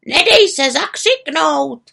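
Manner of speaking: high, slow farewell shout; loud raven character calling goodbye; calling after her. A narrator's reading. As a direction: call it out high and drawn out. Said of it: "volal za ní na rozloučenou".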